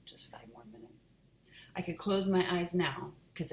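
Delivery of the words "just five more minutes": hushed aside whisper. Real, not acted. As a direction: whisper it to the listener. Reader repeats to child.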